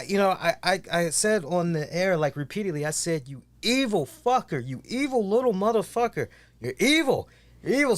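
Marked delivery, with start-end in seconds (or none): 3.3-8.0 mock scolding. Re-enacts scolding the pet on air.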